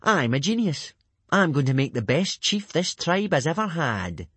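high, loud crowing boast; swaggering self-praise; self-satisfied. A narrator's reading. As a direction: crow about your own brilliance. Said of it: "very pleased with himself".

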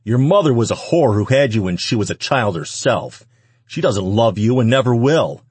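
vicious anger. A narrator's reading. Man insulting the woman's mother, vicious anger. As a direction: hurl the insult at her.

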